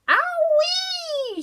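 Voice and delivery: very high, loud scream; Grandma shrieking as the dose kicks in. Acted. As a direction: shriek it out as she shoots up. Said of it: "she screamed".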